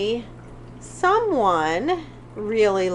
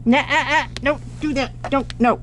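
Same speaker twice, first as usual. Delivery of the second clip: scolding the cat; 3 semitones higher than usual. Real, not acted. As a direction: tell the cat off sharply. Scolding the cat scratching recliners.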